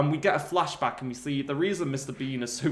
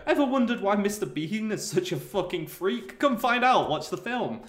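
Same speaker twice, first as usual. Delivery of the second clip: higher, rising hammed-up trailer pitch; mock trailer voice; 6 semitones higher than usual. Pitching the film's trailer, hammed-up announcer voice. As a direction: sell it like a movie trailer.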